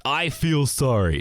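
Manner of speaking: slow, drawn-out pity-me apology; mock sorry. Explaining what sorry sounds like, mock mournful and slow. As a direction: drag out the apology, overdone. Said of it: "Sorry is like this".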